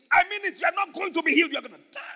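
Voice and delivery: high, loud frantic threat; acted furious insistence; angry Satan. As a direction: rage like a thwarted devil. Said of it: "Satan got so angry".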